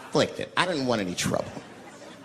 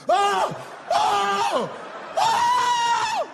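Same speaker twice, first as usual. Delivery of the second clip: high howls of pain, climbing to a shriek; freak-out screams; 3 dB louder, much slower than usual. Acted. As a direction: wail repeatedly in hammed-up agony. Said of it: "This guy was freaking out".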